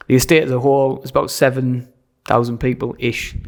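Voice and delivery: usual speaking voice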